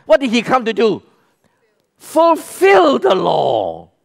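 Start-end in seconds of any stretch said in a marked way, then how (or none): 2.0-3.9 Fulfill drawn out slowly and loud; slow emphatic declaration. Real, not acted. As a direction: proclaim each word slowly. Preacher answers his own question.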